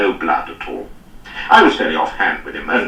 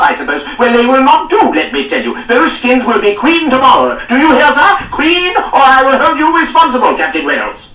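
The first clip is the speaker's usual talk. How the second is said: high, loud, sneering harangue; scornful tirade; 7 dB louder than usual. A narrator's reading. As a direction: pile on contempt and threats. Lord's continuing tirade.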